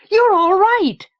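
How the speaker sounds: high, loud relieved shout; joyful shout. Acted. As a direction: burst out with joy. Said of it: "so surprised and so thrilled".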